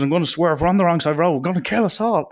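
high-pitched panicked driver impression; mock panic. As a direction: act out escalating alarm at a coming crash.

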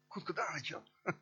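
mock praise.

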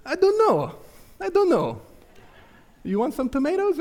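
put-on high, heavy Italian accent, hands thrown up; shrugging old Italian neighbour, heavy Italian accent; Tony's Italian accent. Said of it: "in a really heavy Italian accent".

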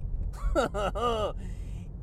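long rippling chuckle; chuckling laugh. Real, not acted. Podcaster urging listeners to take control, chuckling laugh. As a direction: laugh in a quick chain of ha-has. Laughs before his catchphrase.

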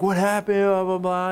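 high, loud babble in imitation; high-pitched mock hollering; mock frantic cry. Retelling frantic visit to doctor.

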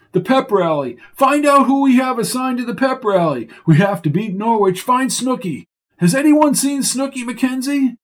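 loud, high frantic orders; delirious shouting. Acted. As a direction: shout like a coach in a daze.

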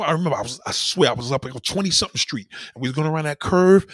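ordinary talk, nothing marked

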